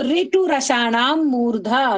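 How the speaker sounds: slow, syllable by syllable sutra chant; slow recitation. Shown as a demonstration. Teacher recites the sutra slowly for the class. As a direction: recite slowly for students to repeat. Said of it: "मेरे साथ बोलो".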